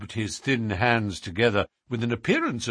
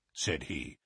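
ordinary delivery, nothing marked

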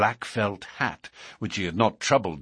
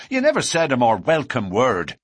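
loud, higher, eager retort; cried out; 4 semitones higher, 6 dB louder than usual. A narrator's reading. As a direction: leap at the fight, voice raised. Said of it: "cried McMurdo, springing to his feet".

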